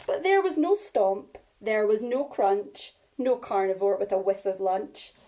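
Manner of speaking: put-on lively read-aloud for young children; story reading. A narrator's reading. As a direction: read it big and bouncy for little ones. Reads picture book to class.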